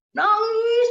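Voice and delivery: hammed-up weeping wife; mock wailing. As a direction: stretch it into a mimicked wail. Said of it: "istrinya tuh udah nangis".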